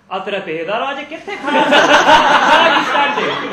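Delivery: high, very loud comic outburst in Punjabi and English; mock outraged shout. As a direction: bellow it in exaggerated disbelief, drawing out the English. Comic outburst at the visitor, later corrected.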